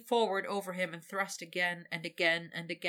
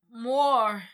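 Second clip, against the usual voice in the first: loud, higher insistent demand; 5 semitones higher, 11 dB louder than usual. Acted. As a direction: demand it again, pushing hard. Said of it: "insistent and reflexive".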